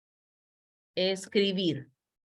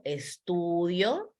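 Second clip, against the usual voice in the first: estudio stretched out slowly with a rising end; slow, drawn-out estudio; 4 dB quieter, much slower than usual. Shown as a demonstration. Teacher shows the conjugated verb to students, drawn out slowly. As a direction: spell out estudio slowly, syllable by syllable. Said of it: "verbo conjugado".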